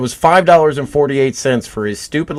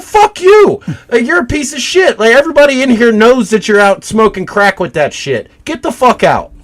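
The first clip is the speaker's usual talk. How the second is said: much higher, louder mock yell; retold shout; 11 semitones higher, 4 dB louder than usual. Angry customer yelling a crackhead out of the store. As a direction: let rip at the crackhead as he retells it.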